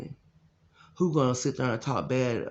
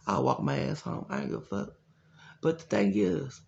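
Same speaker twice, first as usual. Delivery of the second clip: ordinary talk, nothing marked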